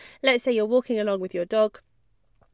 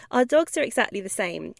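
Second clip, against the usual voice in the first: ordinary talk, nothing marked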